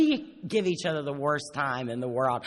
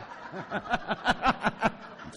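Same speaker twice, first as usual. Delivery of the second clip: airy wheezing chuckle; breathy laugh; 6 semitones higher than usual. Real, not acted. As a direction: laugh silently. Laughing at own story.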